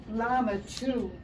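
usual speaking voice

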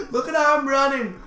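high, loud, laughing-fit excitement; amused exclamation. Real, not acted. Player amazed at how he runs, delighted. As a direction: cry out in delighted disbelief. Amused by his own running.

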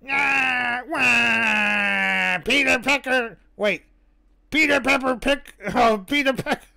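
cartoon villain wail, then tongue twister; hammed-up character voice, fumbling; Wario. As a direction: stumble through it in a forceful put-on voice. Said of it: "tongue twisters with that voice".

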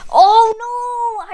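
loud, high drawn-out wail; dismayed cry. Real, not acted. Gamer reacting to a sudden mishap, dismayed cry.

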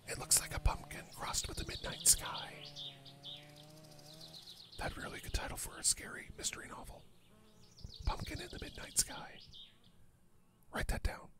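very soft, unvoiced whisper; hushed whisper. Gamer musing on a mystery novel title, whispering. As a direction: whisper it as a secret note to self.

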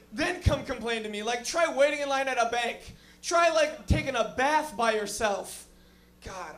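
normal talking voice

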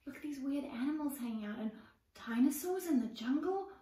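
ordinary delivery, nothing marked

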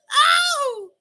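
high party holler; high whoop. Acted. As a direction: whoop to rev up the crowd.

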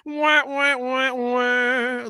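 high sitcom wah-wah sound; sad trombone. Retold or imitated. Mocking season two as a sitcom, high wah-wah horn. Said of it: "it was like a sitcom".

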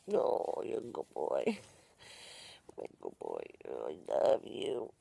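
tender. Real, not acted. Tender talk to dog.

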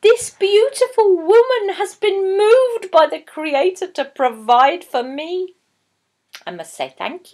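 lightly voiced grateful scholar; a hint of a wondering old scholar; scholar's voice. A narrator's reading. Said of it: "said the scholar".

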